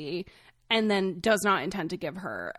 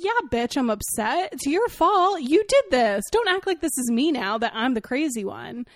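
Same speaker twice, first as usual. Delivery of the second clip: raised, higher pitched indignant rant; mock angry retort; 5 semitones higher, 5 dB louder than usual. Answering a man who says you're upset, mock angry. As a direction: snap back at him in mock fury.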